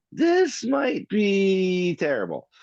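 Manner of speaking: lightly voiced wary scientist; Grant impression. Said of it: "he's always the whole time is like".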